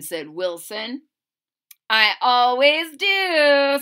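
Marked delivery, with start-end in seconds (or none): 1.9-3.8 louder, higher, slow and sure; Lily boasting, slowly; proud girl. Acted. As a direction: savour every word. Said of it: "said Lily".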